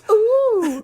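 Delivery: high squealed ooh of a sharp sour hit; a hammed-up pucker squeal; mock sour ooh. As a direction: squeal like the sourness bites you. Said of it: "It's definitely not like".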